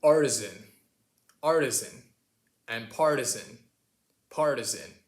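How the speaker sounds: artisan, partisan with a strong R and weak last vowel; loud, clear American R in 'partisan'; American pronunciation. Shown as a demonstration. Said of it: "the way that we would say it".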